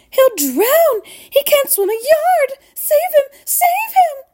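frantic mother, very high and climbing; high, loud, desperate cries; screaming. A narrator's reading. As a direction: shriek in mounting panic. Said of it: "screamed Missus Gloop".

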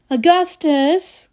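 mild alarmed cry, stretched out. A narrator's reading. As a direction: call the boy with some urgency. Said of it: "cried Mister Wonka".

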